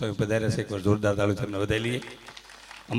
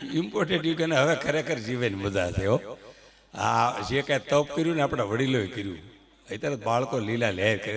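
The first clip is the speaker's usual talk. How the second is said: ordinary talk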